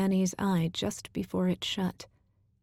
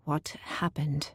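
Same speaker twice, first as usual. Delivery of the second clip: shaken whisper; 3 semitones lower, 4 dB quieter than usual. Acted. T S after swallowing hard.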